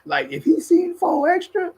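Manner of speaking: high, slow laughing exclaim; exasperated joke. Retold or imitated. Laughing about Dulo, high and slowed. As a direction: marvel in mock disbelief. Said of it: "I be like".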